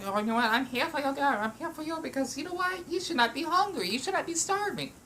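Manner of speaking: high, soft, sweet talking food voice; a cute hot dog comforting the eater. Acted. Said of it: "They, they say, like".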